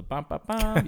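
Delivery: playful vocal trumpet fanfare, jumping up high; trumpet imitation. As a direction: sing out little trumpet notes. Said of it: "the little trumpet sounds".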